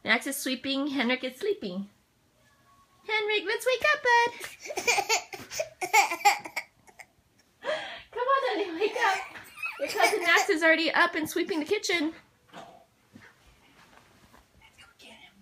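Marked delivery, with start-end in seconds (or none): 3.1-12.1 laughing coax. Real, not acted. Laughing while waking baby. 12.5-15.3 unvoiced whisper. Real, not acted. Whispered aside.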